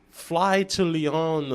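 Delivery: slowly drawn out, mysterious; slow mock text. Chef's odd text read back to the host slowly.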